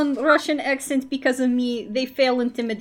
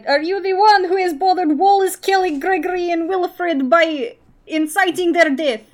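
in-character challenge; 6 semitones higher, 6 dB louder than usual. Acted. Ramsey questions the villain loudly.

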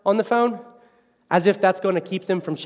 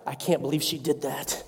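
under-breath grumble; 8 semitones lower, 9 dB quieter than usual. Retold or imitated. Stewing during a fight.

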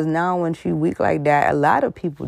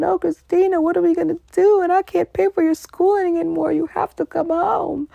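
much higher, dramatic fake-sad mother voice; mock Joan Crawford. Mother lying to Christina she's broke, high theatrical whine. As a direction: put on a tragic act for the daughter. Voicing Mommy Dearest to Christina.

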